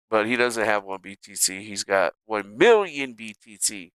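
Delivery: ordinary talk, nothing marked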